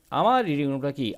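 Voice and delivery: ordinary delivery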